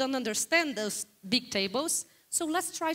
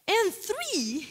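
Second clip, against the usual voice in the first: amused surprise; 7 semitones higher than usual. Real, not acted. Reacting to the audience's answer.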